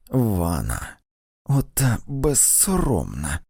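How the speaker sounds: slow, hissed contempt; drawn-out venomous hiss; hissed disapproval. A narrator's reading. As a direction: spit it out slowly in disgust. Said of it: "просичала Сара".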